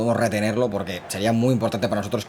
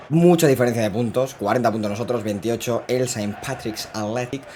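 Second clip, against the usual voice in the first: usual speaking voice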